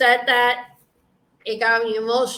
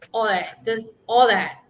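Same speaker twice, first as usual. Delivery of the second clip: normal talking voice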